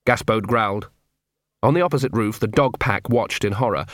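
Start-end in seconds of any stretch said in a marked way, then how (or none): none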